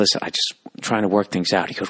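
usual speaking voice

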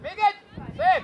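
high, short coaching shout; calling out. Real, not acted. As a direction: shout encouragement to the players. Coach shouts during play. (hangos, biztató kiáltás)

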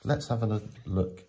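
normal talking voice

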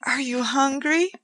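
high, caring question to a pet; woman fussing over a cat; solicitous. A narrator's reading. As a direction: ask the cat tenderly. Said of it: "Joanna asked solicitously".

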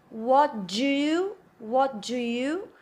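"What do you" run together as one connected chunk; linked words. Shown as a demonstration. Teacher showing the connection to learners, "What do you" slowly linked. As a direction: slowly link "What do you" without breaks between words. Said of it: "eu também fiz o link".

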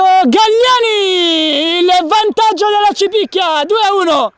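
long stretched high goal yell; goal shout. Real, not acted. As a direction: draw out the scorer's name. Goal called live.

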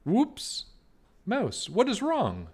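a rabbit tumbling then asking kindly; startled Rabbit. Acted. Rabbit trips over Mouse's tail.

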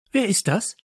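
high, sharp demanding question; startled cry. Acted. Teacher spotting a stranger, crying out high. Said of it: "rief sie".

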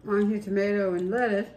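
chewing between words; speaking around food; mouth partly full. Real, not acted. Seen 3: Woman with sandwich shows chewing lip movements and tongue licking between words.

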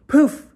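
high, loud "Poof!"; storyteller popping the magic sound, high and loud. Acted. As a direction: burst it out like a magic trick. Narrator voices magic effect.

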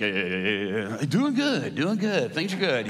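mumbled grunts, then a sing-song reply. Retold or imitated. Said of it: "my cousins are so redneck".

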